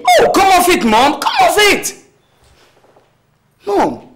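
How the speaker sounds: a son loudly dismissing his mother's plans; exasperated shout. Acted. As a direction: brush her off angrily.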